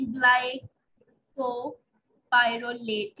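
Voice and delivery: glycopyrrolate stretched out very slowly, each syllable held; very slow repeat. Shown as a demonstration. Teacher repeating the name for students, very drawn out. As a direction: drag out glycopyrrolate for students to memorise. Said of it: "ये आप कर सकते हैं".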